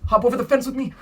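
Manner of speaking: high, loud call to jump along; rally the kids over a pretend fence; excited play-along shout; playful urging. Leads children in a pretend jump.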